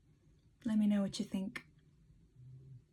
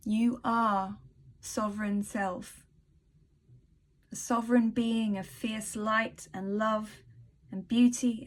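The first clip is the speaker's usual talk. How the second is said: reading aloud. Acted. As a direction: read it like a heartfelt blessing, unhurried. Reads her written blessing aloud.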